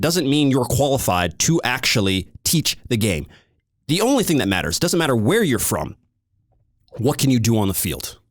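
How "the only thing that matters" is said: ordinary talk, nothing marked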